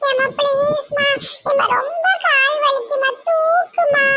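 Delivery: put-on high, drawn-out kid whine; pleading child. Child with aching legs begs mother, pleading. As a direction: beg in a small child's voice.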